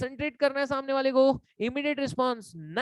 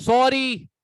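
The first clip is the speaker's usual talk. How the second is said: Sorry snapped loud and sharp; harsh sorry; 2 semitones higher, 7 dB louder, much slower than usual. Shown as a demonstration. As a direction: throw sorry out rudely, loud. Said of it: "tone का फर्क आया". (Sorry ज़ोर से रूखे लहजे में)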